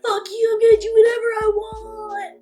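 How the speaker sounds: rude customer. Retold or imitated. Drunk hockey dad defying the rink worker, mocking yell. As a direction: sneer it back like an entitled drunk. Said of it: "they're like".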